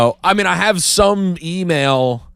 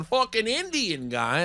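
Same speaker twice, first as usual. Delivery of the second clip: imitating bar guys; 8 semitones higher, 9 dB quieter, slower than usual. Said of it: "they were screaming it".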